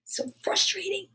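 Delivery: whispered, frustrated aside. Real, not acted. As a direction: sigh it out in a whisper.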